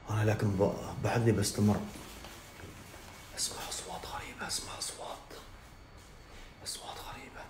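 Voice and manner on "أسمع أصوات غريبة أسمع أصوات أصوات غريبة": whisper, dropping from a soft voice to breath; frightened hushed whisper; scared whisper. Pretend. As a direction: hush it as if something might hear. Hearing noises, scared.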